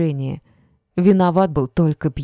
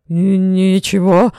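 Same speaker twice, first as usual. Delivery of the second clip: hoarse, slow, stammered croak, louder; 3 semitones higher, 7 dB louder, slower than usual. A narrator's reading. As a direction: croak it out in terror. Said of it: "прохрипел он".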